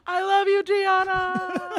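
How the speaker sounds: loud, high farewell yell; exaggerated high-pitched call to a loved one; mock falling cry. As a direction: shout last words as if plummeting, hammed up. Imagined last words while falling.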